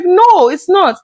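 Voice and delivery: high, loud acted contradiction; emphatic denial. Friend answering a new mother, emphatic acted denial.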